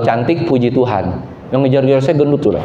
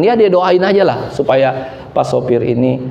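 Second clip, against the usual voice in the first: normal talking voice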